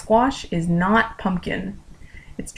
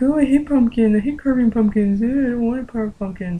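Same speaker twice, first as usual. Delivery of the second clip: mock-whiny, higher voice of grumblers; whining complaint; 4 semitones higher than usual. Everybody refusing to carve pumpkins, mock whining. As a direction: imitate everyone moaning about a chore.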